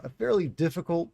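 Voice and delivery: ordinary talk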